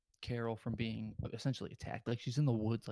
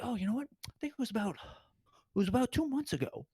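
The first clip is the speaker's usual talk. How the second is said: much higher-pitched mock confession voice; gloating killer impression; 11 semitones higher than usual. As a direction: play a killer casually recalling it, pitched way up. Confessing killer gloats in retold film scene.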